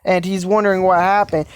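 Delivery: ordinary delivery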